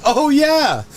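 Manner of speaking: mock girl voice. Retelling the coworker's directions in a high mock voice. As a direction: play the coworker girl cheerfully. Girl's reply in retold story.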